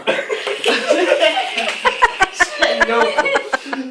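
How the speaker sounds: long, high burst of laughter; loud laugh. Real, not acted. Cracking up while telling friends the story, loud laugh.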